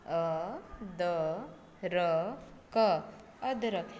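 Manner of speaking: अ, द, र, क spelled out slowly, then अदरक read whole; spelling out sounds. Shown as a demonstration. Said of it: "अ का उच्चारण करते हैं तो एकदम नॉर्मल".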